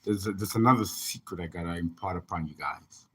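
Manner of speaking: normal talking voice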